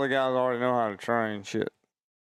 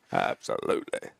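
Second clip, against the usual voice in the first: mock whisper. Echoing "absolutely" mockingly.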